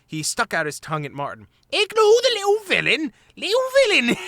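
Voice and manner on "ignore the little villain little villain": very high squeaky mocking rat voice; nasty mimicry. A narrator's reading. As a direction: parrot Martin in a high jeer. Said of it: "mimicking his voice".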